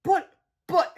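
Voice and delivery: high, halting stammer. Acted. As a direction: stumble over the words. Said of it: "Harold stammered".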